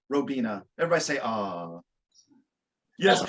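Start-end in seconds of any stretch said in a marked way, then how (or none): 1.2-1.8 low, soft, drawn-out coo; sympathetic aww. Shown as a demonstration. Performer cueing everybody to coo, sympathetic aww. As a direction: lead the crowd in a falling aww. Cues audience's sympathetic sigh.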